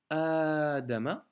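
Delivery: adama slow, the A after d held long. Shown as a demonstration. Teacher showing the long vowel, slowly. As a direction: hold the A in adama. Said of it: "C'est un A prolongé".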